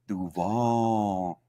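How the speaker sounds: Duval said short, without the long drawn-out "Duuu"; mistaken Duval. Retold or imitated. Football podcaster imitating the new coach's botched Duval, understated. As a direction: say Duval softly, without stretching it. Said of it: "figured out how to say Duval".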